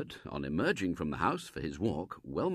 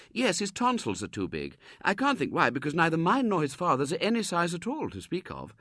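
higher, placid mother's reply; calm and homely; lightly voiced mother; 9 semitones higher, 3 dB louder than usual. Mrs Brown answering the Colonel, lightly voiced and placid.

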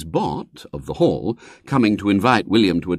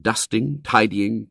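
usual speaking voice

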